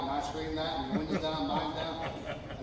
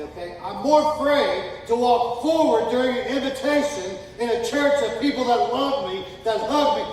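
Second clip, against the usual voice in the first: loud preacher voice, quoting the lost; preaching loud; 5 semitones higher, 9 dB louder than usual. Retold or imitated. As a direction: build it up loudly. Retold fear of walking forward.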